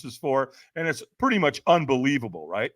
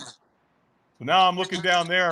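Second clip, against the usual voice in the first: ordinary talk, nothing marked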